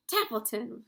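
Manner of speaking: loud yell of a name; scream. A narrator's reading. Wilbur waking the rat, loud scream. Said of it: "screamed Wilbur".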